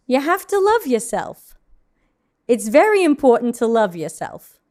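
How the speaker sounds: self-help book read in a thick New York accent; heavy New York accent. Shown as a demonstration. Demonstrating an old self-help audition, condescending New York accent. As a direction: preach self-love in condescending New Yorkese. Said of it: "that's in a New York accent".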